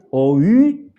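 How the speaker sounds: O U as two sounds, o gliding to ü; wrong diphthong reading. Shown as a demonstration. Teacher shows students what not to say, drawn-out o-ü. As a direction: draw out O U with both vowels heard. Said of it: "No es".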